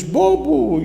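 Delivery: high, loud echo; echoed insult. Acted. Valley repeats the boy's insult, echoing shout. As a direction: throw the insult back like an echo. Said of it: "lá no fundo diz".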